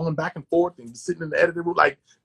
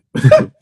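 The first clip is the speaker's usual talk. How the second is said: loud laugh; 12 semitones higher, 12 dB louder than usual. Real, not acted. Laughing out loud while telling friend about pandemic. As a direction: burst of high, loud laughter. Laughing at agents' bleak warning.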